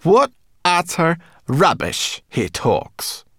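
slow, loud, scornful growl; high-pitched grumpy growl. A narrator's reading. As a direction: growl it out with contempt, dragging each word. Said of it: "she growled".

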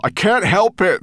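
high, loud strained reply; loud but worn-out shout; weary call back. A narrator's reading. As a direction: call back wearily over the distance. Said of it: "called back to her wearily".

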